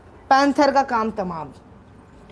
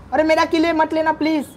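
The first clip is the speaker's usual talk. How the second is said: yelling at teammate; 6 semitones higher than usual. Real, not acted. Gamer yells at teammate over kill.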